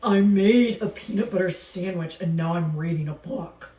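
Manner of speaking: a gruff alligator defending himself; grumpy alligator voice. Acted.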